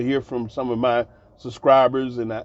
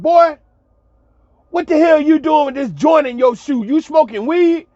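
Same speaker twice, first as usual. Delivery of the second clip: loud, high-pitched outraged holler; retold shout, pitched way up; hollering coach; 11 semitones higher, 6 dB louder than usual. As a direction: act out a coach yelling an accusation. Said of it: "he hollered out".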